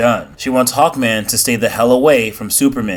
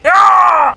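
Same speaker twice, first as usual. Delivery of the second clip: loud, high cry of pain; a sharp yell as the blast hits; pained shout; 6 dB louder than usual. Acted. Hawkman struck by the parasite, crying out loud.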